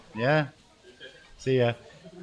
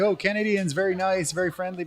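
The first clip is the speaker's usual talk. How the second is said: gushing mimicry; 8 semitones higher than usual. Mimicking people praising Canadians.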